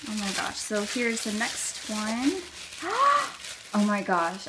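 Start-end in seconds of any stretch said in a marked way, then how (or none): 2.4-3.7 sharp high gasp of delight; a quick thrilled intake of breath. Real, not acted. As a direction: gasp at the surprise. Opening gift, delighted gasp.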